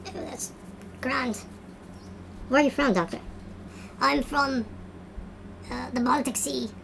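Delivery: put-on Baltic Sea accent. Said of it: "They speak like that in the Baltic Sea?".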